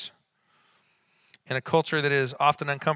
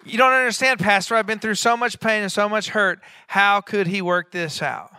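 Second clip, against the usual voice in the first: higher, louder protest, slowing at the end; pleading churchgoer; 7 semitones higher, 6 dB louder than usual. Acted. Imagined churchgoer objecting to the pastor through pain, pleading. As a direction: voice a hurting member's objection, imploringly.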